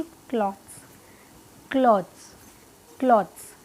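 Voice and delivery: क्लॉथ्स with a plain K and dz ending; an easy, falling "klodz" for क्लॉथ्स; simpler clothes version. Shown as a demonstration. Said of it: "इससे भी काम चला लेंगे".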